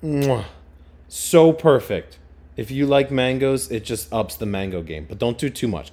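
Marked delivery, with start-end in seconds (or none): 0.0-2.2 loud slow smack then so perfect; chef's kiss. Real, not acted. Praising mango with Tajin with a slow chef's kiss. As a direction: kiss the fingers and savor it.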